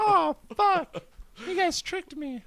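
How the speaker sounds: very high squeaky mock voice, laughing; brother's impression of a friend. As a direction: squeak out a hammed-up dismayed complaint. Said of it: "did the impression".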